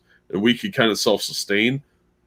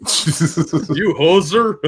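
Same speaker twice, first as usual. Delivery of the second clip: very slow, higher mock Canadian hoser; 7 semitones higher, much slower than usual. Teasing the Canadian streamer, drawn-out mock Canadian accent. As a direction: stretch it out like a hammed-up Canuck. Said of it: "doesn't sound quite Canadian".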